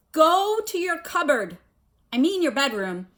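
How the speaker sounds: loud, high, breathless wheeze; a winded uncle gasping orders; wheezing Uncle Vernon. A narrator's reading. Furious uncle wheezes orders at Harry. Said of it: "he wheezed at Harry".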